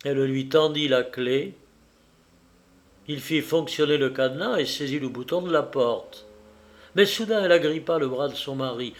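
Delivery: usual speaking voice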